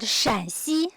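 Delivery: third tone on Shan in "Shanxi". Shown as a demonstration. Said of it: "pronunciation of these two provinces are very tricky".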